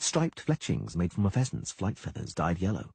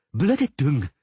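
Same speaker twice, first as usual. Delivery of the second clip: loud, higher yelled curse; 5 semitones higher, 8 dB louder, slower than usual. A narrator's reading. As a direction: bark the curse out. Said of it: "the Dryad yelled".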